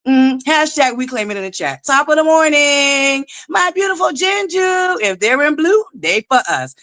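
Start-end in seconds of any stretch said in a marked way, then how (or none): 1.9-5.0 drawn-out, high-pitched shout-out; singsong greeting. Real, not acted. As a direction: sing out a high, stretched hello to a favorite viewer. Calling out greetings to viewers.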